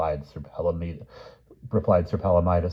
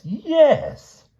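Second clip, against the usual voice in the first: much higher, puzzled yes; mystified; 8 dB louder than usual. Acted. King answers Grumor, high and mystified. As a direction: blurt it out bewildered. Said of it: "considerably mystified".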